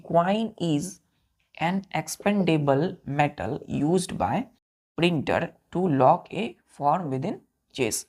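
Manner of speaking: very slow, evenly spaced words; teacher rereading the dictated line to pupils, very slowly; slow read-back. Shown as a demonstration. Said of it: "क्या बोला बच्चों?".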